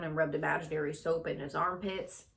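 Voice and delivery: ordinary delivery, nothing marked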